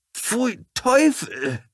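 high, loud shriek of disgust; shrill outburst of disgust; disgusted cry. A narrator's reading. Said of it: "rief sie".

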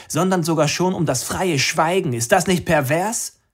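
impassioned activist addressing the masses; rousing protest speech. Acted. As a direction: whip up the crowd from a rooftop.

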